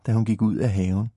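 usual speaking voice

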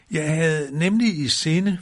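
high, loud, drawn-out character voice; explaining to himself. A narrator's reading. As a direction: explain yourself slowly while tumbling down. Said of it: "forklarede han sig selv". (højlydt, langsom forklaring i lyst toneleje)

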